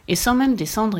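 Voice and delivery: plain reading voice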